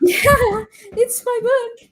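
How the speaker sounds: high, near-tearful confirmation; emotional yes. Retold or imitated. Student confirming to teacher it's her book, high and emotional. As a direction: say it overwhelmed with pride.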